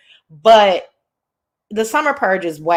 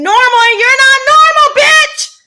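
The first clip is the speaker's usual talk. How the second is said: sudden much higher, much louder yell; furious yell; 9 dB louder than usual. Real, not acted. Fed up with the weirdos, shouting at them. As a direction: blow up at them at full volume.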